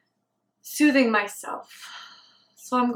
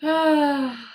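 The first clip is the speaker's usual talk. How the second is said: long exhaled sigh, gently falling; settling sigh; 3 semitones higher, 3 dB louder than usual. Real, not acted. Meditator grounding themselves in the breath, settling sigh.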